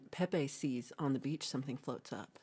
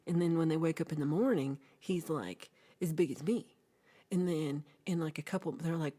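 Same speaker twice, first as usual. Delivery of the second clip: usual speaking voice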